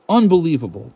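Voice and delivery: exasperated disbelief. Real, not acted. Reacting to the absurd math question, exasperated disbelief. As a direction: throw the word up in disbelief. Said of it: "I can't fucking believe this".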